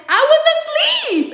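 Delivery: high, loud, drawn-out protest; retold outburst at full pitch; exasperated yell. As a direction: yell it in squealing indignation. Acts out her protest.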